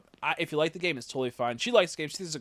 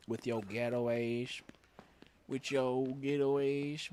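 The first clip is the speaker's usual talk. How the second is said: slow mock jab; slower than usual.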